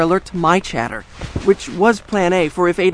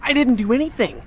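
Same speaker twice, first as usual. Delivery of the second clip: alarmed protest; 10 semitones higher than usual. Acted. Alarmed at police arriving.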